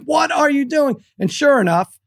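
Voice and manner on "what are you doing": high-pitched reenacted yell; exasperated dad yelling from the stands. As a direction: shout it in disbelief at the sideline. Retelling yelling at coaches.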